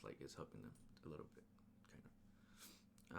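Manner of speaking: fast, hushed whisper aside; whispered under the breath. Real, not acted. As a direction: drop to a whisper for a quick aside.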